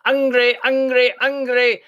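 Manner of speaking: put-on loud, high, repeated baby cry; hollering baby. A narrator's reading. Finn as baby demanding food, loud high hollering. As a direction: bawl it like a greedy infant. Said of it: "baby began to holler too".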